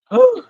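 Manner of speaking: short, high "woo" of shock; high-pitched exhaled holler; high whoop. Real, not acted. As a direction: let out a sharp high whoop. Exclaims amid the dog fight.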